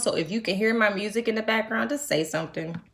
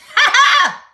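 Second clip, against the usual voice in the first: high burst of laughter; real loud cackle; 13 dB louder than usual. Real, not acted. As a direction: let out a big shrieking laugh. Laughing at her own joke.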